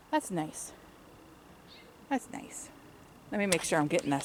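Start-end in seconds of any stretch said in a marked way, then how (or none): 0.1-0.7 soft, low-key remark; quiet. Real, not acted. Repeats remark, then whispers it. 2.1-2.7 breathy whispered echo; whispering. Real, not acted. Repeats remark, then whispers it.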